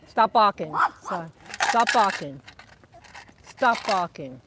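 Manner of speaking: scolding shout. Real, not acted. Owner scolding her little dog for barking, loud firm command. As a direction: cut the barking off with a loud order.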